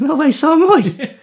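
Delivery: high, laughing, put-on delight; mock amazement. Co-host pretending to meet a fellow speaker, high mock amazement. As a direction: pretend thrilled coincidence, voice pitched right up.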